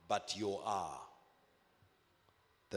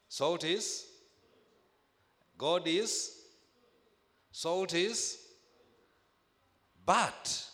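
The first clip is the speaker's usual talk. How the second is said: higher and louder, each "is" rising; preaching build; 8 semitones higher, 6 dB louder, slower than usual. Acted. Preacher rousing his congregation, rising loud calls. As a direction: climb in pitch and volume, calling out to the church.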